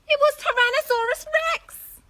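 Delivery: high, loud exclaim; dramatic reveal. A narrator's reading. Narrator revealing the T. rex to young listeners, loud and dramatic. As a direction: announce the monster with alarm.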